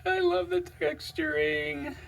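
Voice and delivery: high, slow swoon; awed delight. Real, not acted. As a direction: coo over it in delight. Admiring the knife.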